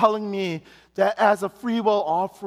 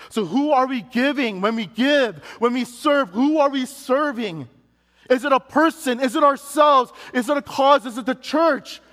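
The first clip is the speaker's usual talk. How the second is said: higher, louder, building rhetorical questions; rising preaching; 6 semitones higher, 4 dB louder than usual. Acted. Preacher challenging the congregation about giving, rising to a shout. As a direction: press the congregation, question after question.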